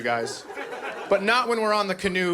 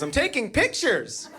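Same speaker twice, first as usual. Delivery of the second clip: higher, slow breezy wife voice; lightly voiced carefree wife; mock wife; 7 semitones higher, slower than usual. Comic quoting his wife not paddling, higher and slow. Wife quoted in canoe story.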